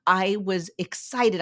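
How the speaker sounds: usual speaking voice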